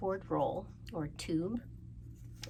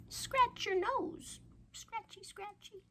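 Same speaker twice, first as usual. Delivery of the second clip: high playful; 14 semitones higher, slower than usual. Acted. Acting out nose scratching.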